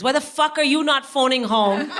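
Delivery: loud, high scolding; angry outburst. Retold or imitated. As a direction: explode at the son in mock fury. Mother explodes at son on phone.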